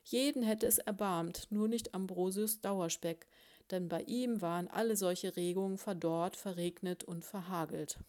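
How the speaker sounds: ordinary delivery, nothing marked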